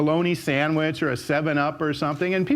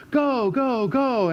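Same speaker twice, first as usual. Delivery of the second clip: imitating crowd cheer; 9 semitones higher, much slower than usual. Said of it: "all these people yelling and screaming".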